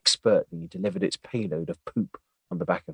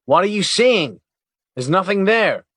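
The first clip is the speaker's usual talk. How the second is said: high, exasperated and louder; frustrated; 10 semitones higher, 7 dB louder than usual. Acted. Agent to partner who points at his back, frustrated. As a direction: frustration boiling over at the prank. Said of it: "he said, getting frustrated".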